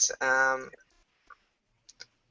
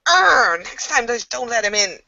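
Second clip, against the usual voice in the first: Kaylee cursing; 8 semitones higher than usual. Acted. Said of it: "Kaylee's like".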